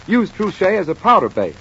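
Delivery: ordinary delivery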